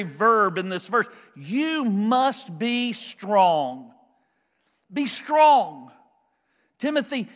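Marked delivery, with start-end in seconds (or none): 1.4-3.9 slow emphatic charge. Acted. Preacher drives home main verb. 4.9-5.9 ringing command. Acted. Preacher repeats the command.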